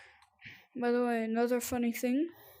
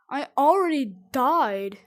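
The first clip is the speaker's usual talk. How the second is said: loud frustrated yell; 3 semitones higher, 11 dB louder than usual. Real, not acted. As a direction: blurt it out loud. Sudden death in game.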